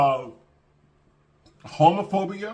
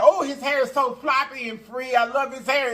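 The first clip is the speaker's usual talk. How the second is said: put-on higher, gushing voice for the friendly older lady; imitating the sweet lady; 9 semitones higher than usual. Older lady complimenting Hussein's hair, mimicked in a higher gushing voice. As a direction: play the lady gushing over the hair, pitched up. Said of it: "She said".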